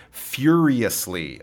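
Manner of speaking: "furiously" said slowly, FYUR leaned on; stress on first syllable. Shown as a demonstration. Teacher models the word, "furiously" slowly stressed at the start. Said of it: "Its stress is on the beginning".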